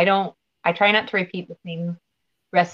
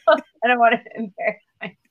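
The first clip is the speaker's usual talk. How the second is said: normal talking voice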